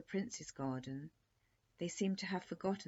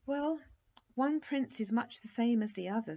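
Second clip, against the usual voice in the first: breezy; 8 semitones higher, 9 dB louder than usual. Acted. Queen's breezy dismissal.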